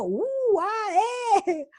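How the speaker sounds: high, swooping little exclamations; imitated childlike amazement. Granddaughter copying her grandmother, playful imitated amazement. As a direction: copy a child echoing grown-up oohs. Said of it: "hace lo mismo, ¿no? Como yo".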